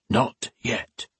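put-on soft, otherworldly voice of the old priest; a quiet ghost speaking at his side; ghostly priest voice. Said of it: "a voice said beside him".

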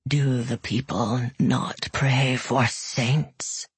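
low, slow rumbling dragon; a bored beast giving in. Acted. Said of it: "asked the man called Juris".

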